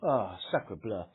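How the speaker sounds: slow, low mock French exclamation; Missus Winton French accent. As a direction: sigh it out like an old Frenchwoman. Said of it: "Is that your European Missus Winton?".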